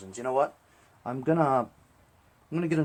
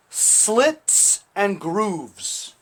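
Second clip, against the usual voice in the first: drawn-out; 8 semitones higher, 7 dB louder, much slower than usual. Pretend. Playful repeat while working.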